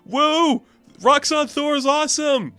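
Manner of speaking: high, loud, cocky cheering; hammed-up hype bro. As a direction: play an obnoxious champion whooping and bragging. Acts gloating, hyped-up rival Thor.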